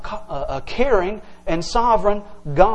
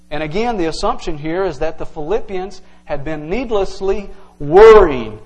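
ordinary talk

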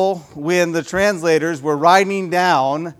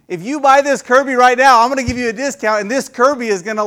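raised, punchy hard-sell voice; salesman pitch; 6 semitones higher, 4 dB louder than usual. Acted. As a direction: pitch it like a pushy door-to-door salesman. Acts out a Kirby salesman pitch.

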